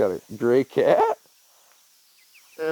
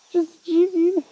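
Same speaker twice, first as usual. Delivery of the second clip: higher coaxing voice to the cat; slower than usual. Real, not acted. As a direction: coax the cat, brighter. Repeating to cat, higher louder.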